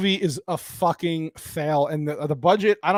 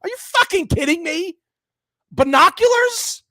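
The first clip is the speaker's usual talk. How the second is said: high-pitched, disbelieving outburst; incredulous outrage; 12 semitones higher, 6 dB louder than usual. Real, not acted. Reviewer appalled that binoculars explain the plot, incredulous. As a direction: pitch shooting up, last word stretched into a question.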